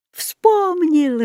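high cry of joyful relief; joyful exclamation. A narrator's reading. Jaco suddenly recalls the spell, joyful exclamation. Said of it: "радостно воскликнул Жако".

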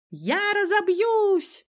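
very high, drawn-out wail; terrified cry. A narrator's reading. Cousin dangling at tower height wails in terror. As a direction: shriek it slowly as if about to fall. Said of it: "кричал кузен с вытаращенными глазами".

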